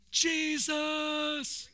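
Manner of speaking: high, drawn-out cry of Jesus; retold scream. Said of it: "Deborah screamed out".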